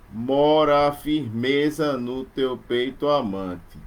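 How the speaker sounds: slow, weighing each beat; slow metrical reading; slow scansion reading. Shown as a demonstration. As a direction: read the line slowly to count stresses. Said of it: "não vai ser mais decassílabo heróico".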